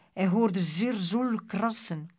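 plain reading voice

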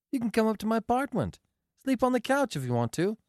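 high-pitched, smiling, breezy invitation. A narrator's reading. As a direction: offer it with a smile in a high voice. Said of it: "smiled Marcia".

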